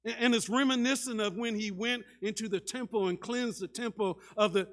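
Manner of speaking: ordinary talk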